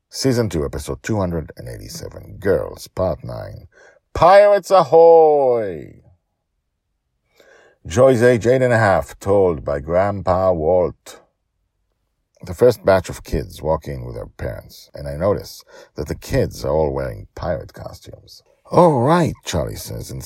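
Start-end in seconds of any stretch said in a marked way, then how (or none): 0.1-11.2 episode title announcement. A narrator's reading. 12.4-18.4 lightly lifted storytelling read; story narration. A narrator's reading. Narrator telling the children's story, lightly lifted. 18.7-19.3 put-on excited boy. Charlie voiced in story.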